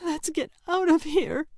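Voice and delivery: high, trembling plea, voice cracking; scared girl begging to leave; frightened sister tugging his sleeve, voice breaking. Acted. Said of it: "Yelda's voice broke".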